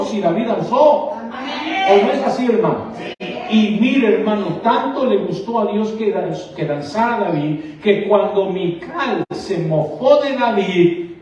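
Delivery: loud, high impassioned shout; shouting preacher; fiery preaching. Acted. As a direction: preach it with full force. Preaching at full force.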